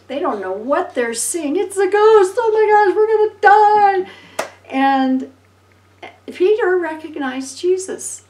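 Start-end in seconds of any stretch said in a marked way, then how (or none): 1.6-4.1 freaking out. Retold or imitated. Disciples in the boat seeing a ghost, panicked scream. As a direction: shriek it like a panicked fisherman. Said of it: "they're freaking out".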